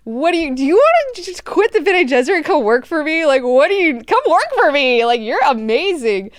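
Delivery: high, loud, gushing delight; mock thrilled. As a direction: gush like a delighted boss. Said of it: "Leto loves it".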